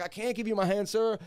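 high-pitched retold pleading; strained protest. Pinned suspect answering the cop standing on him, strained protest.

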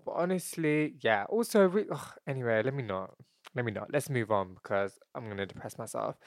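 soft, deflated mumbling to oneself; self-deprecating. Real, not acted. Host backing away from a depressing topic, softly deflated. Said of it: "that sounded way too self-deprecating".

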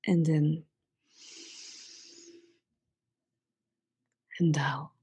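soft meditation voice with long pauses; hushed, spacious breathing cues; guided breathing. Real, not acted. Leads breathing exercise.

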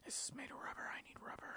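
hushed, greedy whisper. Retold or imitated. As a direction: breathe it like a secret find. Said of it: "you're just like".

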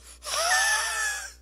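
loud high wheezing gasp; rasping gasp for air; asthmatic wheeze. Real, not acted. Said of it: "That was a really attractive sound".

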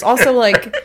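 ordinary talk, nothing marked

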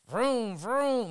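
high vroom vroom engine noise; a lightly voiced toy-car vroom; mock engine vroom. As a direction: imitate a dubbed car engine. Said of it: "he opened his mouth, and it was like".

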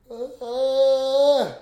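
talking through a real yawn. Real, not acted. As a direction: stretch the words out in a yawn. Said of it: "I gotta yawn real quick".

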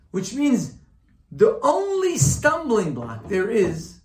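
loud, high-pitched sermon delivery; impassioned preaching. Real, not acted. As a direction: drive the teaching home forcefully. Preaching at full force.

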